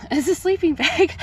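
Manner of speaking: can barely get the joke out for laughing; laughing hard. Real, not acted. Cracking up at own joke.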